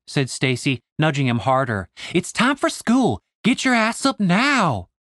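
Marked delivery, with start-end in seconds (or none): 2.1-4.9 high, slow scolding-mother voice; mock nagging mom. Stacy plays his mom to wake Dave, high and slow. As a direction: nag him out of bed like a mother.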